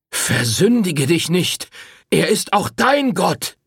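high, slow, grave admonition; stern warning priest. Acted. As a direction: warn her solemnly. Priest warns Rosetta. (ernst mahnend)

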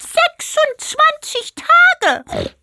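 very high, very slow stretched-out pig question; shocked pig voice. A narrator's reading. Pig hears it is twenty-six days, very high and drawn out. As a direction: drag it out in disbelief like the little pig. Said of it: "fragte Einschwein".

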